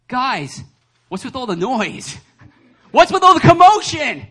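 exasperated shout, building to a high yell; hammed-up outburst at a noisy room; Jesus. Preacher as Jesus scolding mourners, mock yelling. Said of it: "he's just like".

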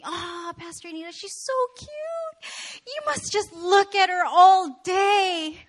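put-on high, drawn-out cooing over a baby; gushing admirer. Admirers cooing to the pastor about her baby, gushing. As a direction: coo it slowly, way up high. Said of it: "many people come up to me and say".

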